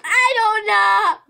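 loud, slow exasperated cry. Real, not acted. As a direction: throw up your hands. Unsure which flavour.

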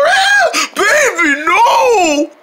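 high sobbing wail; crying plea. Acted. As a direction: beg her back through tears. Pleading as lover walks out.

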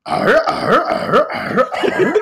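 high pirate growl, last ones breathy; hammed-up pirate noises; mock pirate arrs. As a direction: growl arr like a silly pirate. Reading ellipses as pirate growls.

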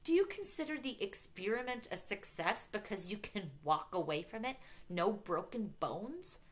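livid. A narrator's reading. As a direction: fire outraged questions at her. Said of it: "his expression was suddenly so livid".

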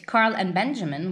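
plain reading voice

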